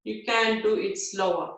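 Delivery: usual speaking voice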